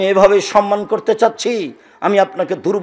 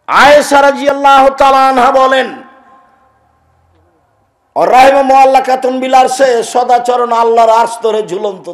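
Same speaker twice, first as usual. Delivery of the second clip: loud, high chanted hadith recitation; fiery preacher quoting Arabic; preaching at full force; 7 semitones higher, 8 dB louder than usual. Acted. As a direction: declaim the Prophet's words to the crowd.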